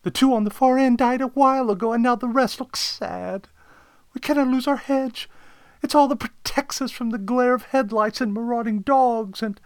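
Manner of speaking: high, loud fretful client; worried homeowner running on, pitched high; distressed caller. Acted. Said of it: "she continued".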